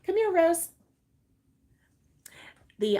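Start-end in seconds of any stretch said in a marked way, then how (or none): 0.1-0.7 high, loud call to the dog; sing-song dog call. Real, not acted. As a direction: coax the dog over. Calling her dog.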